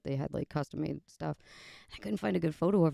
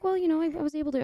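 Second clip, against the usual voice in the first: flustered explaining; 12 semitones higher, 5 dB louder, much faster than usual. Retold or imitated. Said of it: "And I was like".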